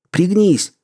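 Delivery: high yell of warning; urgent shout. Acted. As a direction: yell duck at a friend. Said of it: "успел прокричать Том".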